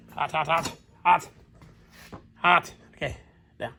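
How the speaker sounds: usual speaking voice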